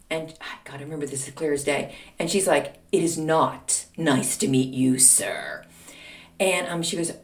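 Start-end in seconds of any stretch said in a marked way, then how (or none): 2.9-5.6 slow, low, measured mock menace; cold rebuff. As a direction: put him down slowly, dropping very low on "sir". Mother coldly rebuffs the teacher.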